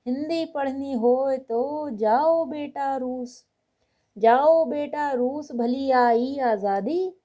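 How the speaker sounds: lilting, emphatic verse reading with stretched line endings; measured, rhythmic kavi-sammelan delivery; comic poem recitation. Acted. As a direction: declaim satirical Hindi verse to an audience. Recites Kaka Hathrasi's satirical poem.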